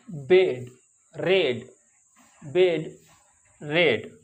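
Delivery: बेड and रेड with a clipped short e; short e sound. Shown as a demonstration. Teacher drilling students on the short e vowel. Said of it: "ए की आवाज़ निकलती है".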